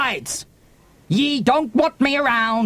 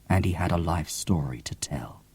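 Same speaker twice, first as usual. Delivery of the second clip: whispered narration; 8 dB quieter than usual. A narrator's reading. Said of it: "narration drops to whisper".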